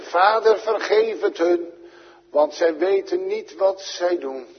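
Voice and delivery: the Saviour praying for his killers; Jesus praying. Acted. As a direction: cry out forgiveness from the cross. Jesus's prayer on the cross.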